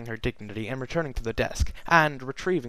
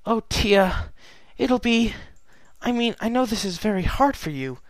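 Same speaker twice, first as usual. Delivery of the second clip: higher, hesitant younger sister; nervous Luna; 10 semitones higher, 3 dB louder than usual. Acted. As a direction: stumble for comforting words. Luna comforts her sister.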